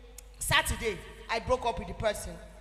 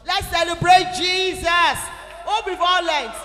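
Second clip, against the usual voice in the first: rallying shout; 5 semitones higher, 11 dB louder than usual. Real, not acted. Speaker rallies crowd to cheer.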